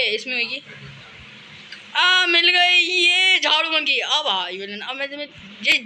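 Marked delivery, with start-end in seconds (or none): 2.0-4.0 high, slow triumphant crow; happy to have the broom; gleeful. Real, not acted. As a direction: gloat over the find. Found the broom, gloating.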